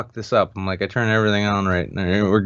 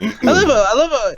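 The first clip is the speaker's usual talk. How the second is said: touched; 8 dB louder than usual. Real, not acted. Touched by patrons' kindness.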